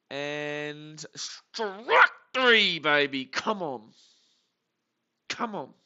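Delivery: loud, drawn-out triumphant yell; excited shout. Real, not acted. Fan roaring at the strikeout, loud and triumphant. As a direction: roar it out like the strikeout just saved the game.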